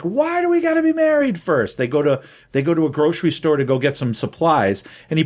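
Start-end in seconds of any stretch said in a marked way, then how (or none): 0.0-1.8 high, whiny complaint, much higher; squeaky whine. Retold or imitated. Man whining at the last woman, high-pitched. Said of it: "And he's like".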